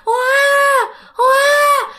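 put-on high, loud wailing baby cry; imitated baby wail. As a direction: wail out like a newborn crying in the night. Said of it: "tiếng khóc nó càng lớn".

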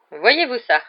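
dry retort. A narrator's reading. Professor scoffing at the seer's prediction, dry. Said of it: "dit sèchement".

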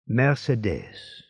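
usual speaking voice

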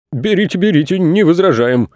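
high, gurgling through water. Acted. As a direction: burble it up from underwater. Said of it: "пробулькали пожарные". (пробулькать)

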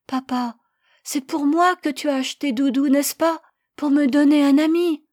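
put-on high, loud pleading little boy; child's voice. Boy begging his parents to spare the lamb, high and loud. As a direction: plead like a small boy defending his pet.

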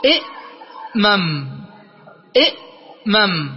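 إئمم drawn out very slowly, each sound clear; slow careful pronunciation. Shown as a demonstration. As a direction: spell out إئمم slowly for the students. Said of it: "سنقول إيش؟". (نطق إئمم ببطء شديد وبوضوح)

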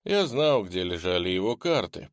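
ordinary delivery

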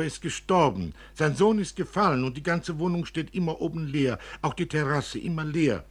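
höher und lauter, eifrig plappernd. Acted. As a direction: das Kind redet eifrig ins Dunkel. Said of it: "sprach eifrig". (eifrige Kinderstimme)

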